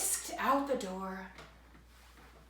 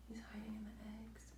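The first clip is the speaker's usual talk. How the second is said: whisper; 2 semitones higher, 17 dB quieter, much faster than usual. Real, not acted. Whispered aside to child.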